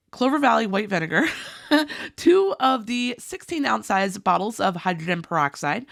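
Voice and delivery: ordinary delivery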